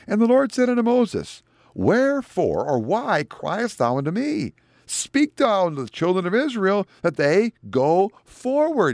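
high, ringing scripture proclamation; preaching declamation. Retold or imitated. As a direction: preach God's command from the pulpit. Preacher declaims God's words.

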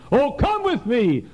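high, slow, loud call; fervent preaching. Acted. Preacher quoting a hymn to the congregation, high and slow.

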